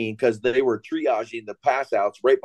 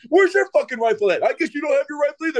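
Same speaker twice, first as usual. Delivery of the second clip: high-pitched sarcastic rant; mock exasperation; 13 semitones higher, faster than usual. Staff sergeant berating yet another Marine, high mock exasperation. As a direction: imitate the sergeant at his wits' end. Acted sergeant keeps ranting.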